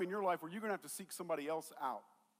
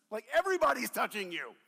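louder, exasperated disbelief; exasperated Peter; 4 semitones higher, 8 dB louder, faster than usual. Retold or imitated. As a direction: roll your eyes at the question.

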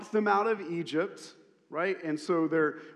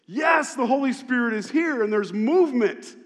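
higher and louder, jubilant; excited praise; 6 semitones higher, 7 dB louder than usual. Real, not acted. Preacher rejoicing with the congregation, excited praise. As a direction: celebrate the Spirit's arrival. Joyful worship exclamation.

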